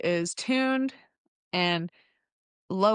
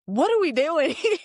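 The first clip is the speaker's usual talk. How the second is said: laughing quote; 8 semitones higher, 4 dB louder than usual. Retold or imitated. Boyfriend puzzled at random store.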